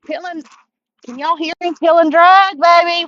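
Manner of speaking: thrilled and loud over the drag; hyped up, loud and high; excited. Real, not acted. Reel drag screaming, fish running.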